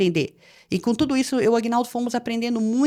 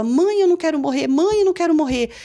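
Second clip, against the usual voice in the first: high, raised voice, pleading; retold child's cry; 6 semitones higher, 4 dB louder than usual. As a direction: imitate a frightened son calling out. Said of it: "e gritava". (grito suplicante de menino, imitado)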